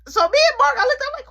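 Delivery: ordinary talk, nothing marked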